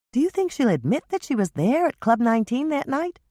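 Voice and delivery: bright teasing younger sister; younger sister Michelle. A narrator's reading. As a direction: younger sister joking about a fight. Michelle voiced higher.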